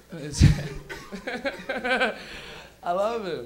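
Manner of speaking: ordinary talk, nothing marked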